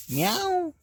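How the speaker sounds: very high, soft squeaky kitten meow; playful meow. Pretend. Mother meowing softly to her baby, high squeaky kitten voice. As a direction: squeak it like a tiny kitten to make her look.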